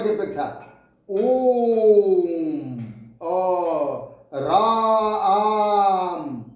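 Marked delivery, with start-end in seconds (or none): 1.1-2.8 ओम chanted long and loud; prolonged pluta vowel. Shown as a demonstration. Teacher showing triple-length vowel, chanted ओम. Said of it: "तिगुना समय लगे". 4.3-6.4 राम held extremely slow, आ stretched; prolonged vowel. Shown as a demonstration. Teacher demonstrating pluta to children, very long राम. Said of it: "अ का उच्चारण होता है".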